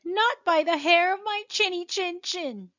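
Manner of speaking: high, defiant pig voice; squeaky little pig refusing. Acted.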